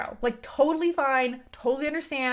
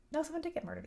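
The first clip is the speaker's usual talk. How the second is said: ordinary delivery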